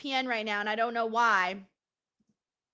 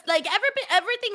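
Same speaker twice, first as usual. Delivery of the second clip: ordinary talk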